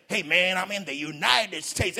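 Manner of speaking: slow put-on American accent, lightly voiced; mock American accent. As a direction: show off a fake American accent. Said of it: "you have even have that accent".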